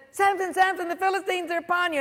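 high, fast warning cry; hammed-up urgent shout; mock alarm. Delilah raising the alarm again.

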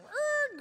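high, loud car squeal; imitated tire screech. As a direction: squeal like a car reversing hard. Imitates car reversing and stopping.

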